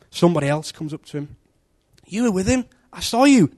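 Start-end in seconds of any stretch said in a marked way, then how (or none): 2.1-3.5 higher accusing voice. Acted. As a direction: point the finger, higher. Someone accuses Peter.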